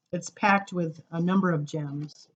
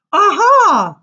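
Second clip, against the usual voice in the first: eager aha; 12 semitones higher, 13 dB louder than usual. Acted. Child in poem answers eagerly.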